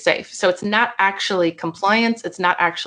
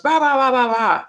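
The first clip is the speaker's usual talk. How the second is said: mock angry growl; 6 semitones higher than usual. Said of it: "Anger then stands in front and goes".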